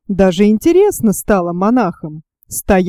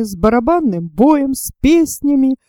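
plain reading voice

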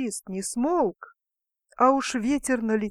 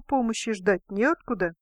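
plain reading voice

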